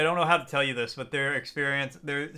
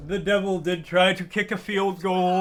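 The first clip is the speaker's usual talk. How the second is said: preaching; 4 semitones higher, 4 dB louder, slower than usual. Pretend. Mock sermon continues.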